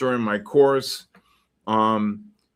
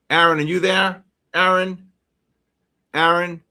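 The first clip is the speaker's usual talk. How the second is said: louder, higher call for the moderator; 6 semitones higher, 5 dB louder than usual. Real, not acted. Streamer calling moderator Aaron to delete the post, calling out. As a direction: reach him across the room.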